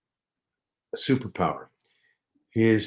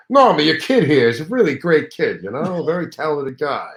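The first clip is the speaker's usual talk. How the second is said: raised, louder gravelly-free comic voice of a showbiz star; Rodney impression; 6 semitones higher, 6 dB louder than usual. Comedian praising son to his mother, hammed-up star voice. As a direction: ham up the big-shot comic schmoozing a mother. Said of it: "And he's going".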